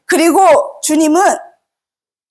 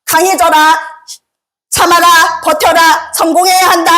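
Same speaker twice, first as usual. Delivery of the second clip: world's demanding orders; 2 semitones higher than usual. Retold or imitated. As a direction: bark out what the world demands. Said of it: "세상은 우리에게 말합니다".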